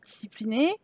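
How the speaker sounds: quiet, rising disbelief on discipliner; puzzled question. Real, not acted. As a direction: ask discipliner back, doubtful and rising. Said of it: "gna, gna, gna".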